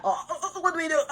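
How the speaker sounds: high, panicky flustered teammates. Retold or imitated. Stunned teammates watching the captain cry, high and flustered. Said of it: "everyone will be so stunned".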